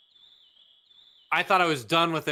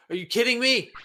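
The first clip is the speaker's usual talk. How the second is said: higher, louder disbelieving outcry; exasperated outburst; 8 semitones higher, 4 dB louder, faster than usual. Real, not acted. Gamer yelling at an unfair level, exasperated. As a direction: throw it at the game in disbelief.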